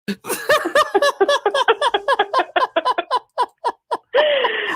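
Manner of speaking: long, loud high-pitched laughing fit; real uncontrolled laugh; helpless laughter. Real, not acted. Host cracks up at the story.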